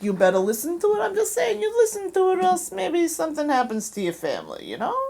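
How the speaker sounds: high, softened mock-Jersey wise guy; veiled threat in a Jersey accent. Shown as a demonstration. Mock-threatening listeners to hear the show, Jersey accent. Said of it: "I can do Jersey".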